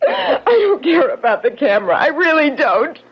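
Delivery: crying and begging not to be hit again; desperate sobbing plea. Acted. Woman begging her attacker through tears, sobbing and terrified. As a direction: sob out the plea, terrified of the next blow. Woman pleads while being beaten.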